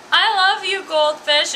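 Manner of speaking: a hint of a kid gushing to his pet; loving kid. Kid to his goldfish, mock adoring. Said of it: "he's like".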